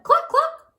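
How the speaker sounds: high, loud clucks; squawky hen cluck. A narrator's reading. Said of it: "Then she said".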